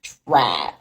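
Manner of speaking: low, dropped mocking take on TRA-; distasteful imitation. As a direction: drop your voice on TRA-, mimicking with distaste. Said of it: "the way people say it".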